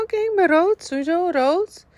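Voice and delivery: high, eager certainty; excited. Retold or imitated. Recalled confident thought.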